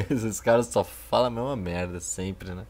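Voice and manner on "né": usual speaking voice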